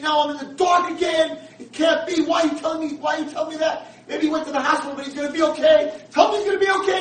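high, loud grief-stricken shout; desperate screaming. Retold or imitated. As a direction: shout pleading in despair. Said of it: "He starts screaming".

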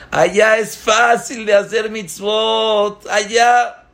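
God calling out to the soul leaving for the world; urgent shout. Acted. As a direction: call after the soul with urgent insistence. God urges the departing soul.

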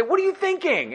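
very high-pitched scolding outburst; playfully appalled, pitch shooting up; mock scream. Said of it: "gonna scream at you and say".

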